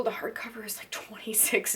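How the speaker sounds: whisper. Real, not acted. Confiding the price.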